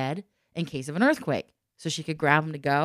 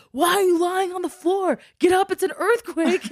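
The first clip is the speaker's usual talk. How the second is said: high, loud urgent fatherly shout; urgent call; 7 dB louder than usual. Retold or imitated. Dad urging his fallen daughter up, high urgent shout. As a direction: hurry her up off the floor.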